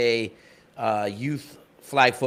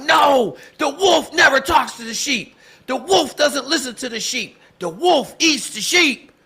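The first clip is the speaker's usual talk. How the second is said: put-on high, loud, rousing chant of excited boys; fired-up kids; 12 semitones higher, 5 dB louder than usual. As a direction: shout it like pumped-up kids. Kids fired up answering dad.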